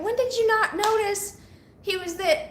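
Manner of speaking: high, disbelieving question; incredulous. Retold or imitated. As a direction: ask it in mock disbelief. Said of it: "I'm like".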